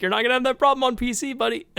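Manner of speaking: high, fast mock-teasing voice. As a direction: rib him in a high, quick voice. Teasing friend in retold banter.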